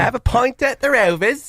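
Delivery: high mock Mancunian; hammed-up Mancunian; Manchester accent. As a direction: say it like a Rovers regular.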